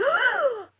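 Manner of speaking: sharp, high loud gasp; acted gasp of shock. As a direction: suck in air as if struck. Reenacts being hit like a brick.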